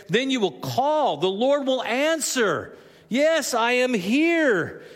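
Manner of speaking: high, slow, drawn-out declaration; emphatic scripture proclamation. Real, not acted. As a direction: declare the promise slowly, stretching each key word. Rereads the promise for emphasis.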